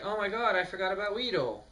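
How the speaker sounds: high, sudden panic; surprised Ash. Retold or imitated. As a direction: gasp it out as you remember. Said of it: "Ash is like".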